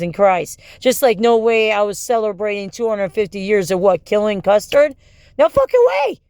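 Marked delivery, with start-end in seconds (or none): 5.4-6.2 a sudden, much higher, louder blast of outrage; outraged outburst. Real, not acted. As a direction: explode in disbelief.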